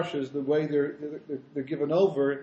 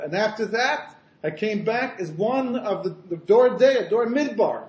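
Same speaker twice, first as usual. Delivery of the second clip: raised, building to a loud reveal; rabbi's proclamation; 5 semitones higher, 5 dB louder than usual. Acted. Rabbi revealing more to swaying Chassidim, grand proclamation. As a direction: proclaim each past life grandly, higher and louder. Rabbi's second revelation in story.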